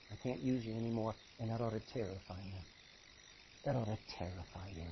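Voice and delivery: a solemn, hushed warning; grave warning. Acted. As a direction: warn them gravely and low.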